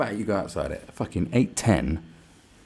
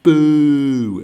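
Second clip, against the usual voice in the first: loud, higher heckling boo; drawn-out boo; 6 semitones higher, 12 dB louder than usual. Shown as a demonstration. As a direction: boo like a protester. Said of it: "Just go".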